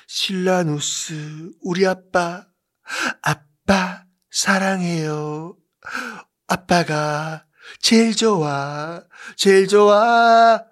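느리게 늘어지며 애교 섞어; 아빠에게 조르듯 달콤하게 고백하기; 애교 부리는 황후. Acted. 황후가 새아버지에게 애교 고백. (새아버지에게 고백하는 황후, 느린 애교 말투)

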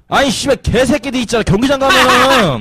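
worked-up sweary complaint, stretched at the end; indignant rant. Real, not acted. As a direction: rant about people who disgust you. Comic rant about couples at stadium.

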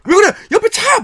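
high, urgent mock panic; imitated passenger's yelp; alarmed exchange. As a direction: warn of a car in alarm. Acting out sister's car panic.

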